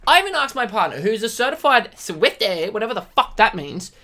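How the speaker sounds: ordinary talk, nothing marked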